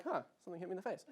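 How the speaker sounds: quick, quiet, offhand remark; mock nonchalance, soft and fast; unfazed. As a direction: toss it off as if barely noticing. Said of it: "I didn't really notice".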